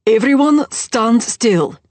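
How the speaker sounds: loud, slow, firm call to the room; urged loudly. Acted. Host calling out to guests in the dark, loud and slow. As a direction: make them all stand still, slowly. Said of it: "Ewan urged loudly".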